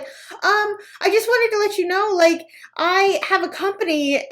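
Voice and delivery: high, gushing compliment; butter her up sweetly; high sweet stranger. Retold or imitated. Saleswoman complimenting a stranger, high and sweet. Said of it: "she's like".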